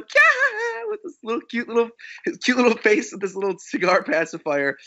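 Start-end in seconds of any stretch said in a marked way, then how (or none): 0.0-0.9 high-pitched imitation squeal; baby's gleeful squeal. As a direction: squeal like a delighted baby, much higher. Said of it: "Pez is laughing and smiling. He's going".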